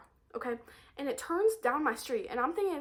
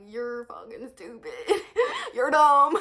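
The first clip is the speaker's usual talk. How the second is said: laughing through a teasing insult; giggling insult; 7 dB louder than usual. Retold or imitated. To the guy, giggling while calling him dumb. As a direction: giggle it out, then punch dumb loud. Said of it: "I literally giggle at him".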